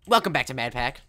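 loud cheery intro voice; youtuber intro. Shown as a demonstration. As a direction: hype up the channel intro. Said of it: "Actually, wait".

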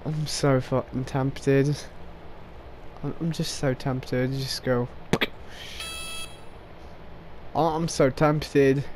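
tempted murmur. Real, not acted. Alone, itching to shoot teammates.

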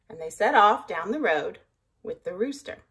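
usual speaking voice

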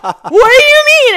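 high, very loud shriek of disbelief; panicked yell. Retold or imitated. Athlete retelling her reaction to the judge, panicked yell. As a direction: shriek it in mock outrage.

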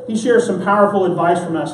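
usual speaking voice

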